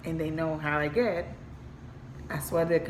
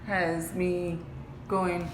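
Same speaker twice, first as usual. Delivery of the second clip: usual speaking voice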